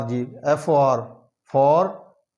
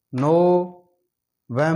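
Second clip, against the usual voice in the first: November drawn out very slowly in syllables; slow syllable drill; 2 semitones higher, 3 dB louder, much slower than usual. Shown as a demonstration. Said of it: "तीन है इसमें". (नवंबर को बहुत धीरे syllable में बोलना)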